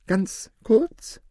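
high, tentative question; a timid little query; unsure. A narrator's reading. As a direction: ask it uncertainly, pitch well up. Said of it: "fragte die Mutter unsicher".

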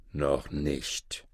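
low, soft refusal; ruhiger Maure. A narrator's reading. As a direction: hold him back quietly in a low voice. Moor calmly refuses the boy. (leise, tiefe Stimme des Mauren)

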